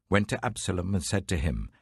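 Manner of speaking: usual speaking voice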